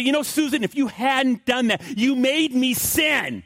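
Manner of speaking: high, drawn-out accusing whine; mock-indignant, high-pitched blaming; blame-shifting. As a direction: pin it all on your wife, slowly. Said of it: "he doesn't want to hear Frank say".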